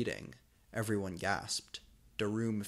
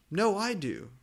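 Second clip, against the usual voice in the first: high-pitched shouted insult; jealous yell; 9 semitones higher than usual. A narrator's reading. Draco yelling at Vampire over the seat, shouting. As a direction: scream at the rival.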